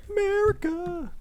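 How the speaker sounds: high, drawn-out patriotic America; over-the-top patriot. Acted. Patriotic mock cheer.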